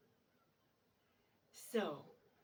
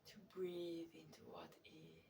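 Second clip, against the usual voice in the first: guided meditation; 6 dB quieter than usual. Real, not acted. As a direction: settle into soft, unhurried guiding, letting phrases hang.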